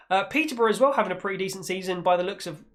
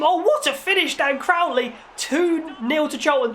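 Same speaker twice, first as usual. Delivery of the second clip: goal call; 8 semitones higher than usual. Acted. Commentator celebrating Crowley's second goal, excited. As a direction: shout the finish like a TV commentator.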